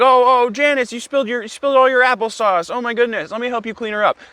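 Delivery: high-pitched fake concern; feigned helpfulness. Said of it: "Trying to be as helpful as I can".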